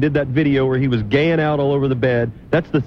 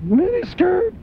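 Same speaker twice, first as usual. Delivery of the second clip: high whiny voice; much slower than usual. Pretend. Mimicking the whiny voice.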